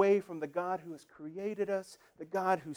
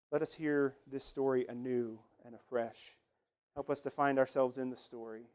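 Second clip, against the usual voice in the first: pastoral prayer; 5 semitones lower than usual. Acted. Pastor leads the congregation in prayer.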